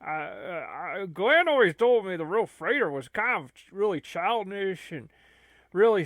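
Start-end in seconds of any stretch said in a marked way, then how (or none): none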